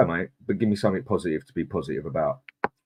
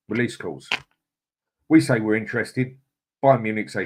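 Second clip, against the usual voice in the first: normal talking voice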